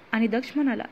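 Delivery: usual speaking voice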